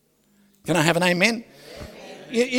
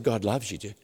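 tender, softer reassurance; 10 semitones lower, 11 dB quieter than usual. Retold or imitated. Tender words to the woman.

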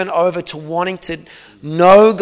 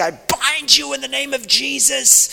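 high, commanding rebuke; warrior prayer; 8 semitones higher than usual. Retold or imitated. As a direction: command the spirit to leave. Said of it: "warfared against that thing".

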